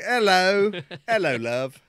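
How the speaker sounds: loud, high mock-British greeting; British accent. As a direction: belt out a put-on British hello. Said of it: "practice your British accent".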